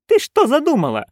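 high, alarmed outcry; narrated cry. A narrator's reading. Nanny asking what her friend plans, crying out. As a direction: cry out high, at normal volume. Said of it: "вскричала нянюшка".

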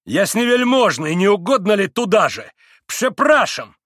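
high, loud mock courtesy; high, ringing exclamation; exclaimed invitation. A narrator's reading. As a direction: call it out with exaggerated politeness. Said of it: "воскликнул к маленькому пану".

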